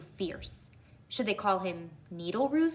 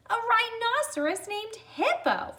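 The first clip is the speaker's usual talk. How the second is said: higher voice, a touch of laughter; laughing cry; 4 dB louder than usual. Acted. Porcupine laughs back at the rhino's name, higher. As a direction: cry it out, delighted. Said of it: "Fluffy cried".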